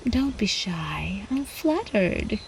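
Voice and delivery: slow, teasing whisper; the countess whispering to a shy captive, slow and teasing; slow seductive whisper. Acted. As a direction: whisper it to tease him closer.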